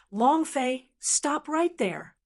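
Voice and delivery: high, drawn-out cry to halt; mild scream. A narrator's reading. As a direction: call out high, stretching the last words. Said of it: "screamed at the top of his lungs".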